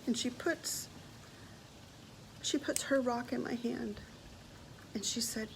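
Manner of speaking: soft, fighting back tears; quietly choked up. Real, not acted. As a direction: let the voice go small and fragile. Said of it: "I'm gonna get emotional".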